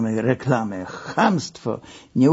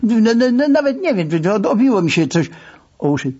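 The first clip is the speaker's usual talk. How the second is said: stuttering in panic, higher pitch; nervous stammer; 7 semitones higher, faster than usual. Acted. Deputy panicking on the phone with his boss, stammering. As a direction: trip over the first sounds in fear.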